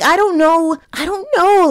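high, bewildered, overwhelmed; dazed fan panic. Retold or imitated. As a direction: sputter it out helplessly, utterly overwhelmed. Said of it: "I was like".

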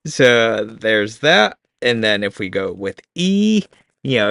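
ordinary delivery

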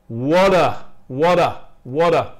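"What are" blended into "whadda", loud and repeated; reduced ARE. Shown as a demonstration. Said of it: "el A suena más como un A". ("What are" enlazado, con "are" reducido a una "a")

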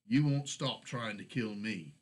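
plain reading voice

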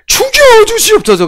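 high, falling plea of a kneeling courtier; begging subject from a historical drama. Retold or imitated. A courtier begs the king for death in a historical drama, high pleading cry. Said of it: "사극 봐요".

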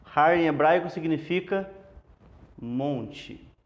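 usual speaking voice

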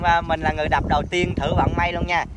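normal talking voice

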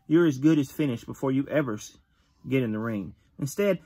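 ordinary delivery

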